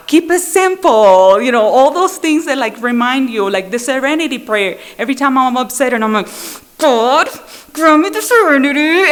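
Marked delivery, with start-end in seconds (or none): 0.1-1.4 louder, slow and higher slogan; reciting a recovery slogan, slow and emphatic. Retold or imitated. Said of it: "stupid little slogan that we have". 6.8-9.1 higher, louder, mock tearful plea; upset wife praying for serenity, mock tearful; mock crying prayer. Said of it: "I'm, like, crying to my teeth".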